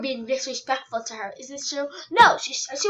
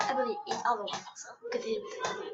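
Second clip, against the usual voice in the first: whisper; 6 semitones lower, 12 dB quieter, faster than usual. Acted. Muttering to self.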